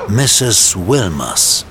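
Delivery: ordinary talk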